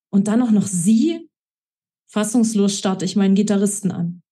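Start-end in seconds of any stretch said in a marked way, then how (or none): none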